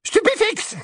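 very high, piercing yell; shrieked spell. Acted. Bellatrix fires a spell at snatchers, shrieking. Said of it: "hurla-t-elle".

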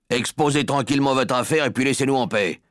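rude dismissal. Acted. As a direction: brush her off like a pushy salesman. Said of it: "On aurait dit qu'il s'adressait à quelqu'un qui voulait lui vendre un aspirateur".

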